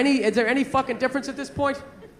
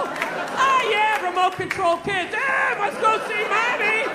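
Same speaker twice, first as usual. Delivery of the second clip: slow, higher-pitched put-on voice; lightly hammed-up happy compliance, stretched out; mock remote-control kid; 7 semitones higher, slower than usual. Remote-controlled kid going back to mommy, mock cheerful and slow.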